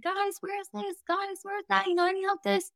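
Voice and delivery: high, soft, whiny little voice; mock helpless pleading; helpless newbie. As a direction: play the lost, helpless newcomer begging for help. Retelling her own helpless messages.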